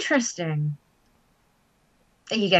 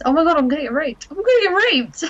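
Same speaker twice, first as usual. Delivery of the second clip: mock panic; 8 semitones higher, 3 dB louder, faster than usual. Mock panic at dodgy venue.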